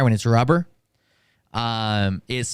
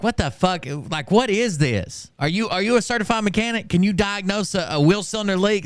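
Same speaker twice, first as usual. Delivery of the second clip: heated challenge; 8 semitones higher than usual. Retold or imitated. Retelling own angry challenge.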